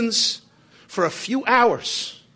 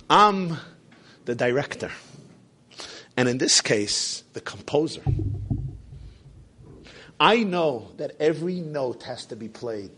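a hint of a lower, quieter maestro; calm master's reply; 7 semitones lower, 3 dB quieter than usual. [name] answers the biographer, lower and understated.

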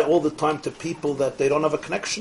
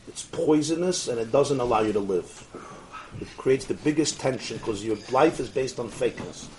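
normal talking voice

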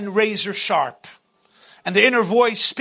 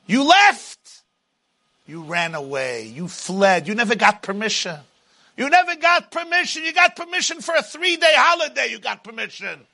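booming motivational speech; 6 semitones higher than usual. Acted. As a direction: rail at the runaway slave. Pharaoh voice accusing.

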